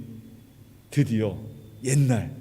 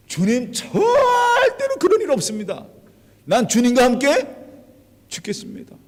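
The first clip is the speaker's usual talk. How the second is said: Peter's earnest protest; 7 semitones higher, 4 dB louder than usual. Acted. Peter protests loyalty to Jesus. (높고 느리게 간절히 맹세하는 베드로)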